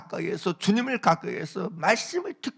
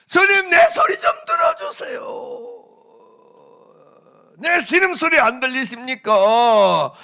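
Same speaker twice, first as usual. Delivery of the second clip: high, slow, pleading shout; desperate cry; 8 semitones higher, 10 dB louder than usual. Acted. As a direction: cry out to God in anguish. Voicing believers crying out to God. (주님께 울부짖는 신도의 간절한 외침)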